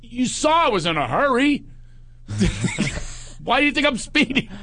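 high-pitched yelling back; mock indignant holler; retold yell. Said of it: "I yell back".

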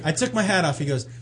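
ordinary talk

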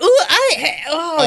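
pleasantly startled dog. Acted. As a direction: voice a dog surprised by cool comfort. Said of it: "that might be like".

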